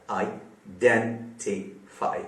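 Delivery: Identify broken into four slow syllables. Shown as a demonstration. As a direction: break identify apart, pausing after each syllable. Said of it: "चार syllable वाला". (Identify को चार हिस्सों में रुक-रुक कर)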